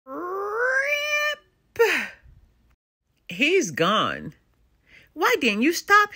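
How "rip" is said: high, stretched ripping noise; rip sound effect. Acted. Narrator voices the page ripping as Papa Bear escapes. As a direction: voice the page tearing.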